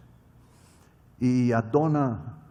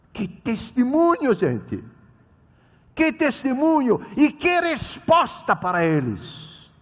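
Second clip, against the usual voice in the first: fervent exclamation; 8 semitones higher, 5 dB louder, slower than usual. Real, not acted. Preacher exclaims to congregation.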